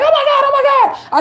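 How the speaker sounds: high, mild mock scream; imitating a frightened passer-by; high squeal of fake fright; mock panic. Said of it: "they're gonna scream and be like".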